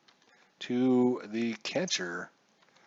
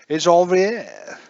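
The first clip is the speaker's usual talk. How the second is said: Lavier loud, slow, hammed-up French; French accent; 6 semitones higher, 7 dB louder, slower than usual. Answering the chat, hammed-up French accent. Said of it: "Do you want me to give it a French accent?".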